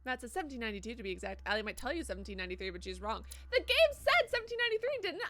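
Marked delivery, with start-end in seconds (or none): 3.5-5.3 high, loud objection; loud protest. Real, not acted. Player disputing chat's date, loud protest. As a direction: argue back, high and loud. Argues with chat over a date.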